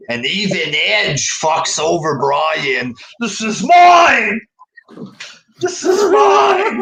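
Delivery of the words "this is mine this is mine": high, loud mock gloating shout; mock greedy gloat. Host mimicking Edge gloating over the title, hammed-up shout. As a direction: ham up a greedy villain claiming the belt. Imitating Edge's heel gloating.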